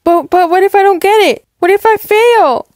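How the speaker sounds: high, rushed worried daughter; anxious panic. Acted. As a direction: blurt out your fear of failing. Daughter panics about failing.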